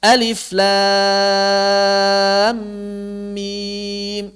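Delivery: "alif, lam, mim" spelled out very slowly; slow letter names. Shown as a demonstration. Teacher spelling out "alif, lam, mim" letter by letter, slowly. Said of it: "kalau kita bacanya".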